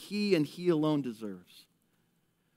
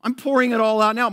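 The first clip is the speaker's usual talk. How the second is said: determined woman; 5 semitones higher, 6 dB louder than usual. Retold or imitated. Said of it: "And she's like".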